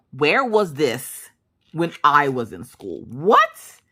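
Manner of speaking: can't believe you missed out; incredulous. Retold or imitated. Mock outrage at missing out.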